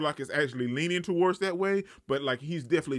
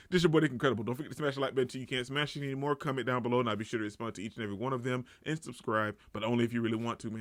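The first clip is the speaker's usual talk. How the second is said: quick, softer outro patter; softer, hurried channel outro; vlog sign-off; 4 semitones lower, 5 dB quieter, faster than usual. Acted. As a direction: rattle off the sign-off like a routine.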